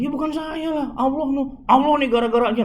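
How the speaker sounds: high, altered voice deflecting blame; Jibril's defense. Acted. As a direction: lift the voice high and protest innocence. Jibril answers, voiced by preacher.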